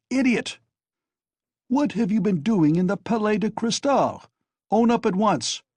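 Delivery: high, loud furious scolding; angry scolding. A narrator's reading. Razumihin scolds Raskolnikov angrily, loud and high. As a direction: berate him, outraged. Razumihin keeps berating him.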